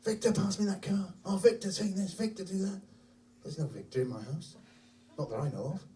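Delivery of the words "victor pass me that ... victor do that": put-on higher-pitched little boy's voice; light, high child-at-play voice; young son playing. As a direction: imitate a child chatting to an imaginary friend. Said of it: "heard him up in his room, saying".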